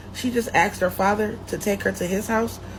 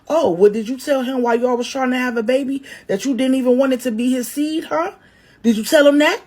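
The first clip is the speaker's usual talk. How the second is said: fast, loud, higher comeback; snapping back; 5 semitones higher, 7 dB louder, faster than usual. Acted. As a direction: fire it back, building to the last question. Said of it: "Kiwi sharks back".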